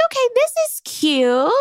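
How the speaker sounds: lightly high, sweet aside; mock sweet. Host retelling her reaction, lightly high and cooing. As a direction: coo it lightly. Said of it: "I was like".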